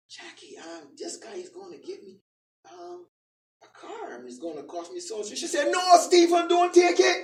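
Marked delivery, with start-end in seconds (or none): 0.1-5.4 very soft, halting phone admission; acted-out sheepish, hushed voice; hesitant confession. Retells timid phone call to Jackie. 5.7-7.2 raised, louder acted-out objection; imitating an alarmed refusal; emphatic warning. Acts out Jackie's refusal.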